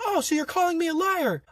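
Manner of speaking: very high-pitched imitation of offended authority; mock indignant instructor. Instructor challenging a recruit's denial, mock indignant.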